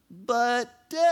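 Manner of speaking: put-on high, loud whining child; kid's whiny complaint. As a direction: whine the protest.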